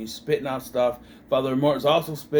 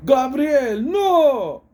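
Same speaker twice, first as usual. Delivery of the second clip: loud, high anguished movie shout; dramatic cry; 11 semitones higher, 7 dB louder, much slower than usual. Retold or imitated. Acting out the film's cry during Father Mort's possession, dramatic shout. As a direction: cry it out, long drawn-out "no".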